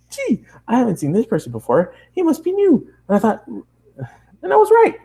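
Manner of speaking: high, excited nonstop chatter; bubbly pony girl. Acted. Bubbly girl chatters at the new traveler, high and excited. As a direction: gush at the newcomer in a bright rush. Pinkie continues.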